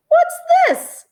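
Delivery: a high squeak of surprise; surprised question. Acted. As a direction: sound startled by a find. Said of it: "she said".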